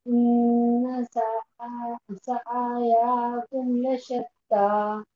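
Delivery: very slow, drawn-out recitation; student reciting slowly from the marked place; Quran recitation. Shown as a demonstration. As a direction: stretch each syllable of the verse. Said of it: "यहीं से पढ़ना है".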